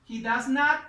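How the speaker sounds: ordinary delivery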